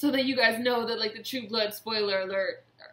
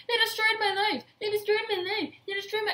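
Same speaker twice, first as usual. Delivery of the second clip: high, repeated mock whine; mocking Karen; 12 semitones higher, 4 dB louder than usual. Parodying Karen's endless complaint, high mock whine. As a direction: wail it over and over. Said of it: "She says that shit, like, fifty million times".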